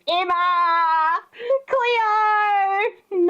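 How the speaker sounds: high, drawn-out melodramatic cries; mock-panicked calling. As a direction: call out names in hammed-up alarm. Mimics cartoonish panicked calls.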